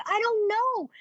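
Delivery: very high, drawn-out retold protest; exasperated helplessness. Answering a reproachful sister, high exasperated protest. Defending herself to her sister.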